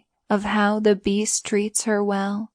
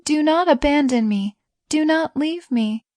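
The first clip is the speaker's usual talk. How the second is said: high-pitched plea, only mildly raised; understated screamed appeal in a raised pitch; 7 semitones higher than usual. A narrator's reading. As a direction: plead high, as if crying out. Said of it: "Beauty screamed".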